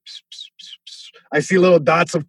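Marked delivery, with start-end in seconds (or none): 0.1-1.1 tiny voiceless bird chirps; very soft repeated psst-like tweets; imitated birdsong. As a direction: mimic birds circling after a knockout. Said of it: "I hear birds".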